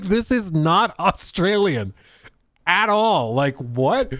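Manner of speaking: loud, high retold protest; incredulous protest. Friend answers claim he is Australian, imitated incredulous protest. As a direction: imitate baffled indignation, stressing each word.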